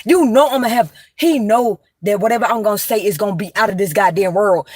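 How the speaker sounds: ordinary talk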